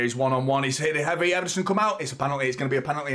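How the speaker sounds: urgent commentary climbing to a high peak; tense live commentary. Real, not acted. Fan watching Vinicius one on one, rising real alarm. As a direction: build the alarm as the attacker breaks through.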